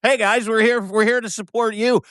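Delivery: high-pitched, eager greeting; slack-jawed Canadian. Retold or imitated. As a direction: call out brightly as the friendly Canadian. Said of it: "some slack-jawed Canadian going".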